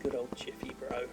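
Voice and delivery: hushed, breathy whisper of mock longing; fond whisper. Real, not acted. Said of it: "I'm missing Jiffy Bro already".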